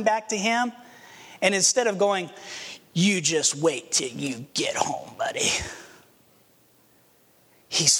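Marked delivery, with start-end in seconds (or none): 2.9-5.5 stern threat. Acted. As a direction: whisper the threat like a stern dad. Father's scolding the son expected.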